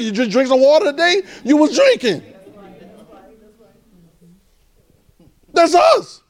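high, fervent preaching climb. Acted. Pastor pressing congregation about everyday life, fervent. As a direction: press it home to the church, rising.

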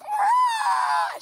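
very high, loud imitated shriek; hammed-up yelp of fright; mock shriek. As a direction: shriek in panic. Amy shrieks at the pill.

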